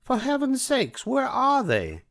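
shrill theatrical Cruella asking; Cruella de Vil. A narrator's reading. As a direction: demand it like an impatient diva. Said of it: "she asked".

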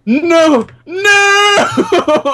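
high, loud cries of defeat; anguished shout. Real, not acted. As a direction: wail at losing. Loses the race.

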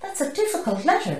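high, loud squeal. Acted. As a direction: squeal it like a worried class. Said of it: "the class squealed".

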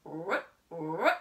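playful pig snort; pig grunt. A narrator's reading. Pig sound in story.

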